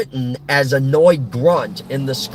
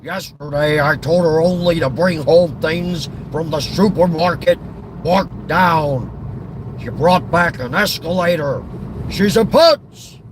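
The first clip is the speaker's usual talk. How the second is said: Walter Matthau. Acted. Griping about his wife the moron, exasperated actor impression. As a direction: complain like an exasperated comic grouch. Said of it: "impression of Walter Matthau".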